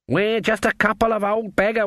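high, put-on old woman's voice; old beggar woman act. A narrator's reading. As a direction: play a harmless beggar, pitched high. Witches pose as beggars.

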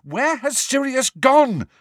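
high, loud, slow yell. A narrator's reading. Harry yells after the departing elf, loud. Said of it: "Harry yelled after the elf".

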